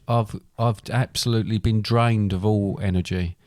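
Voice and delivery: ordinary talk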